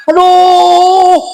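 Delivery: mock pain cry. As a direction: yelp as if the massage hurts. Acting the pain of massage. ("aduh" kesakitan pura-pura)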